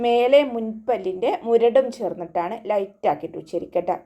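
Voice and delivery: usual speaking voice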